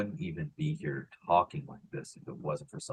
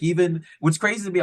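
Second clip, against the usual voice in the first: normal talking voice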